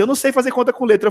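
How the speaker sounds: fast, high-pitched mock outcry; imitated cry. Pupil who never spoke cries out to her teacher, imitated high outburst. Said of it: "que ela gritou assim".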